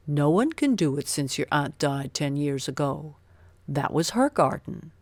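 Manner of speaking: a lightly voiced old servant, sombre; gardener's voice. A narrator's reading. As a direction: gardener Ben telling Mary the door stays shut. The gardener Ben refusing.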